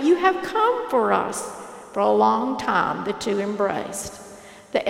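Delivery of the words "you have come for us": joyful shout. A narrator's reading. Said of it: "and he shouted".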